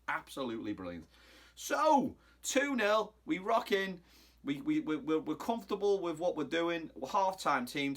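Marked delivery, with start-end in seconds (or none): none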